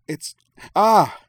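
sudden loud high cry of pain. A narrator's reading. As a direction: break off into a sharp pained cry. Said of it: "she cried".

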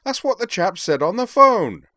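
very high, loud defensive cry; high-pitched yelled protest. A narrator's reading. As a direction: shout your excuse indignantly. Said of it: "Devlin protested".

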